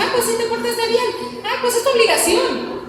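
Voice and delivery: high, quick dismissive brush-off; dismissive parent. Retold or imitated. Parent shrugging off a child's good behaviour, dismissive. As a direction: toss off the praise as if it's nothing owed. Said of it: "Porque a veces decimos".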